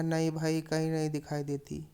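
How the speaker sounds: plain reading voice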